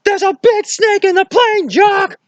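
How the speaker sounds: Indy, scared of snakes, shrieks at Jock in a hammed-up high voice; mock panic. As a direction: squeal about the snake in terror. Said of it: "It was like".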